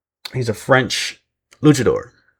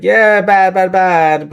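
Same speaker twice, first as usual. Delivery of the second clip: high, loud sarcastic parody of a bland remark; mocking Robert Stone; 9 semitones higher, 6 dB louder, much slower than usual. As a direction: ham up his lazy "bad, bad". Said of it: "the way he just says that".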